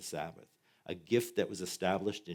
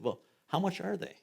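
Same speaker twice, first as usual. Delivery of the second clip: much higher put-on voice asking the price; stingy Dutch voice; 11 semitones higher than usual. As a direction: squeak out the haggler's question.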